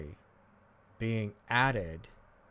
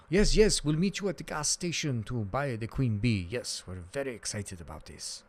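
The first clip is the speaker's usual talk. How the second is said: bad Russian accent; 2 semitones higher than usual. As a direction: do a shaky amateur Russian accent impression. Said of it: "that was a shitty Russian accent".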